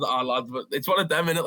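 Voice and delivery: normal talking voice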